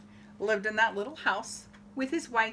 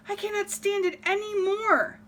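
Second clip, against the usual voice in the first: exasperated farmer; 9 semitones higher than usual. Acted. Fed-up farmer complains.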